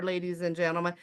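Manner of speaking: ordinary delivery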